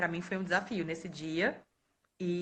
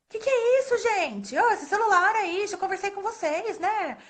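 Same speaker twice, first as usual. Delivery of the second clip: raised, scolding teacher voice; retold scolding; 10 semitones higher, 4 dB louder than usual. Librarian scolding students she thinks have a phone. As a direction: tell off the class loudly.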